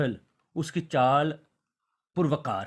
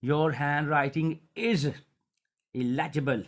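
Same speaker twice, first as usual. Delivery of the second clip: slow dictation; much slower than usual. Acted. Teacher dictating an English sentence.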